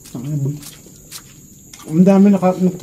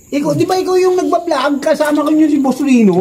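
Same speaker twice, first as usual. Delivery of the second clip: high-pitched imitation of an eager stranger; curious stranger; 9 semitones higher than usual. Stranger asking if he is the vlogger, high and eager. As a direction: put the retold question up high. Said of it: "pare, ganito".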